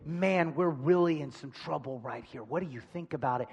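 quiet, easy, companionable voice; friendly welcome. Acted. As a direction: welcome him gently and ask his advice. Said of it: "This voice never says".

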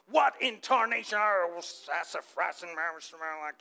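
grumbling cartoon fake cursing, drawn out; Yosemite Sam. Imitating a favorite Looney Tunes grumbler, fake-cursing grumble. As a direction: sputter mock curses that sink into a grumble. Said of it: "My favorite grumbler is Yosemite Sam".

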